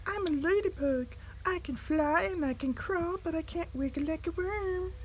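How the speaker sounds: high little ladybug voice. A narrator's reading. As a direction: answer brightly like a tiny bug. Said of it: "said the bug".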